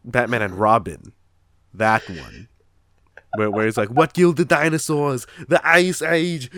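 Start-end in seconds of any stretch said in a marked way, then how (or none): 3.9-6.5 loud, higher-pitched action-villain quote; Arnold Schwarzenegger. Retold or imitated. Quoting the villain of Batman and Robin, hammed-up Arnold impression. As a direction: belt out the movie pun with a big laugh. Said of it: "Arnold Schwarzenegger".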